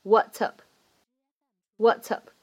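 What's up linked smoothly. Shown as a demonstration. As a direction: run What's and up together. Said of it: "可以做连读".

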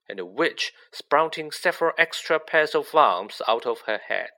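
ordinary delivery

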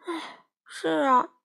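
slow sad sighing reply. Acted. Sheep sighs over his lost scooter, slowly and sadly. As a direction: let it out on a weary sigh. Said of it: "卷毛羊叹了口气".

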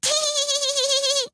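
very high, drawn-out titter; little woman's high tittering laugh; irrepressible giggle. A narrator's reading. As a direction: let a tee-hee burst out. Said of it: "laughed the little woman".

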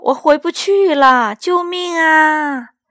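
high, slow, loud cry for help; panicked elephant shouting 救命啊; yelling for help. Acted. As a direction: bellow it out, trapped and scared. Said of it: "他哇哇大叫".

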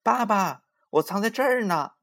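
put-on high child's voice calling for Dad; impatient child's high-pitched holler; loud calling out. A narrator's reading. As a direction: call out from a hiding place. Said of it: "就大声地喊起来".

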